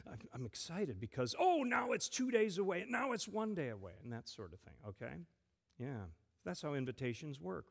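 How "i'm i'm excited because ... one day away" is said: imitating a giddy kid counting down, pitch jumping higher; excited. Pastor to children, acts out eager countdown excitement. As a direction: gush like a child who can hardly wait.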